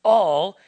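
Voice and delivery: "all" hit loud and held; emphatic, drawn-out "all"; stress on all. Real, not acted. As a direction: underline "all" with a strong punch. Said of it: "underline it".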